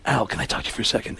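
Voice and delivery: hushed, low, quick aside; Alec Baldwin. Acted. Baldwin asking Pacino for a word, hushed and quick. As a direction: ask it quietly and privately. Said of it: "and Alec goes".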